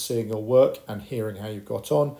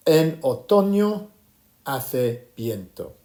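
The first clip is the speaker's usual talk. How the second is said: teacher's drawn-out Spanish demonstration; slow language model; 3 semitones higher, 5 dB louder, slower than usual. Shown as a demonstration. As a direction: call it out slowly for the class to repeat. Teacher models phrase for class repetition.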